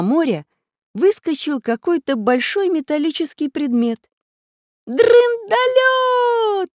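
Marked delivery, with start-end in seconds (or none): 4.9-6.7 very high, loud, drawn-out cheer; joyful shout. A narrator's reading. Children spot their flying machine, shouting happily. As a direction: stretch the word in a delighted cry. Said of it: "весело закричали ребята".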